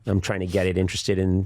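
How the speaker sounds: usual speaking voice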